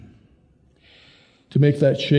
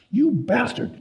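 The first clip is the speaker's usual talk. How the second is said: enraged retort; 11 semitones higher than usual. Acted.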